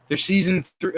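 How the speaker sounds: ordinary delivery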